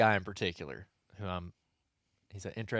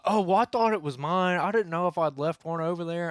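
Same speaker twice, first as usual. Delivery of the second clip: imitating a caught-out man, higher and louder; flustered excuse; 7 semitones higher, 6 dB louder than usual. Club member caught with someone else's camera, loudly backpedalling. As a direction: blurt the excuse in a raised mock voice.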